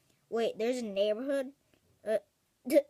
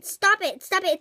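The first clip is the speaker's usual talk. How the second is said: annoyed; 6 semitones higher, 6 dB louder than usual. Acted. Annoyed neighbour scolds laughers.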